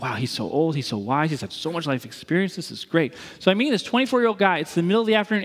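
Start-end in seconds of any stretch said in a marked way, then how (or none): 0.0-3.1 mock awe. Young self awed by older friend.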